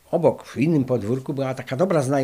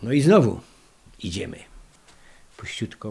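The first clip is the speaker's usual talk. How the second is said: normal talking voice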